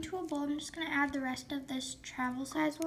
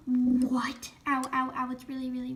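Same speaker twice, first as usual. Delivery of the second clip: breathless whispered pain; whispered ouch; 3 semitones lower than usual. Real, not acted. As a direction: hiss out the ow's. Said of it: "really hot".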